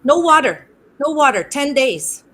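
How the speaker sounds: loud mock Slavic-accented doctor's orders. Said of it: "He was quite Slavic".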